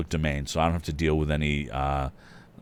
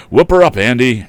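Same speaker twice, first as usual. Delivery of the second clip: high, loud whoop; excited cheer; 6 semitones higher, 13 dB louder than usual. Acted. Lad beside the driver urging Andy on, excited. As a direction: cheer him on to go faster.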